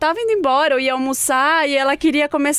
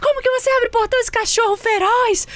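angry neighbor; 11 semitones higher than usual. Retold or imitated. Retelling the neighbor scolding her over the dog, high mock shriek. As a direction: imitate the furious neighbor. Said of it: "Ela já começou".

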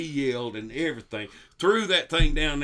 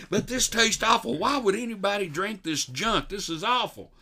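loud complaint; 6 semitones higher than usual. Retold or imitated. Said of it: "He'd get louder and louder".

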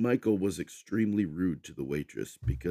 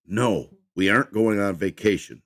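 sharp, angry retort, a bit louder; snapped; 4 semitones higher, 7 dB louder than usual. Acted. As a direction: bite the words off in irritation. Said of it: "Michael snapped".

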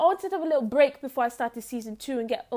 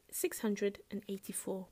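whisper; 3 semitones lower, 11 dB quieter than usual. Pretend. News read trails to whisper.

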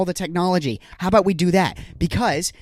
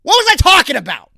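flustered; 10 semitones higher, 6 dB louder than usual. Real, not acted. Flustered after spill.

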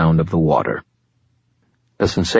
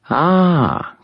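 higher, silky voice of an ancient fallen angel, calm and superior; fallen angel Lashiel; 12 semitones higher than usual. Acted. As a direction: speak as a patient otherworldly being, a little above it all. Lashiel speaks.

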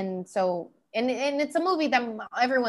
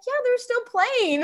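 earnest agreement; 12 semitones higher, 4 dB louder, slower than usual. Retold or imitated. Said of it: "I was like".

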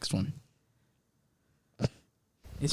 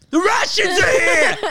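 high, loud hammed-up scream; mock screaming; 7 dB louder than usual. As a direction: scream it as a comic sleep-talking alarm. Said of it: "What if she just started screaming".

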